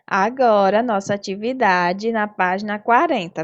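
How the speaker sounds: ordinary delivery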